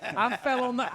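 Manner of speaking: ordinary talk, nothing marked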